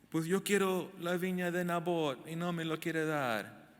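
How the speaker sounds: King Ahab mock-whimpering that Naboth won't hand over his vineyard; whiny crybaby king. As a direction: whine like a spoiled king who didn't get his way. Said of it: "Y un chillón".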